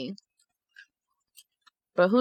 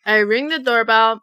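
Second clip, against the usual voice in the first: ordinary delivery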